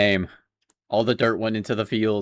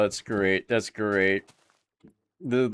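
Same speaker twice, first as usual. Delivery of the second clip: normal talking voice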